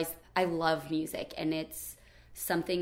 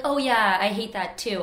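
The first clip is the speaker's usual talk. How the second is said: imitating eager agreement, higher and louder on the opening; mock agreement; 4 semitones higher, 7 dB louder than usual. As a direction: chime in loudly like someone who thinks they relate. Quoting people's breezy agreement.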